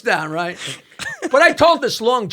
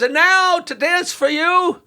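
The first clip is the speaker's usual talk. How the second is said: emcee announcement; 8 semitones higher than usual. Retold or imitated. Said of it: "He goes".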